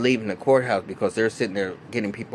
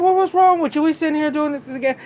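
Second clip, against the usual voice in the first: much higher, louder scoffing taunt; hammed-up high-pitched mom sneering at Holly; mocking mom voice; 7 dB louder than usual.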